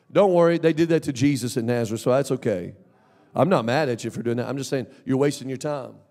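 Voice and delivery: ordinary talk, nothing marked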